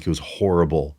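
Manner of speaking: low, quiet dejected mumble; mild mock misery, dropped low; glum kid. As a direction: sound like a crushed kid. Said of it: "I'm like".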